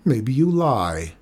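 slow, suspicious grumble; drawn-out, mildly grumpy doubt; grumbling accusation. A narrator's reading. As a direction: accuse him grudgingly. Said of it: "grumbled Rico".

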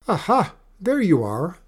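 high, loud cry of discovery; mother dragon. A narrator's reading. Mother dragon finds Benny on shore, high triumphant voice. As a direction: pounce on the child you were hunting.

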